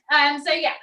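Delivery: ordinary talk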